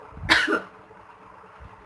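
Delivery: a harsh unvoiced cough; coughing fit. Real, not acted. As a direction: cough hard and rough. Coughing after the chickney challenge.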